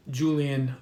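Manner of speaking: usual speaking voice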